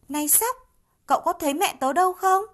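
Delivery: put-on high, loud and fast child's question; little monkey asking. A narrator's reading. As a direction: ask urgently, like a small child. Little monkey asks the squirrel. (khỉ con hỏi sóc, cao, to, nhanh)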